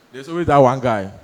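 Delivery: ordinary delivery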